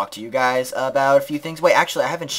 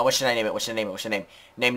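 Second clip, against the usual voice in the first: ordinary delivery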